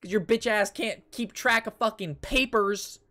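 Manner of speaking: ordinary delivery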